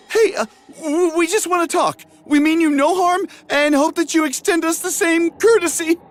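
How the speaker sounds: very high hesitant call-out; nervous high hero voice. Acted. Hero parleying with shadow.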